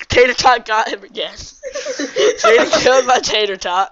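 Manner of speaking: slow words through laughter; laughing. Real, not acted. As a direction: giggle through the report. Laughing while retelling the kill.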